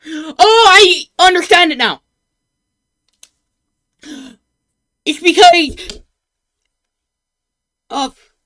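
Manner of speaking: high, loud eureka cry; excited realization. Real, not acted. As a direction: burst out with sudden understanding. Sudden realization mid video.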